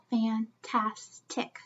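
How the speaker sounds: fantastic stretched slowly, fan-tas-tic; slow syllable split. Shown as a demonstration. Teacher slowly chunking the word for kids. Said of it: "It's tick".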